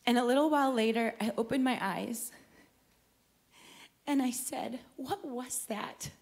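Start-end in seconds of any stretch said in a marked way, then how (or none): 5.0-6.1 soft, slow, bewildered question; hushed, slow retold amazement; dazed wonder. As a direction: imitate coming round, quietly stunned. Dazed question after falling over.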